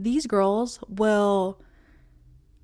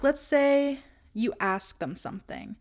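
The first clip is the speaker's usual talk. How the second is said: ordinary delivery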